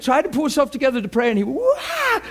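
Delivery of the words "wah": mock wail. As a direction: imitate a man bursting into sobs. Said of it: "cried harder than you ever see a man cry".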